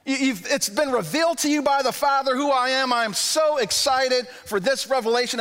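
gushing with delight at Peter's news; excited Jesus. Retold or imitated. Jesus telling Peter he is thrilled, excitedly. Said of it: "it's like Jesus is saying".

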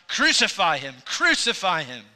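loud mock crowd chant of Crucify him; retold crowd cry. Preacher voicing the crowd demanding crucifixion, a loud retold shout. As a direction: call it out like the baying crowd. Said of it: "they cried out".

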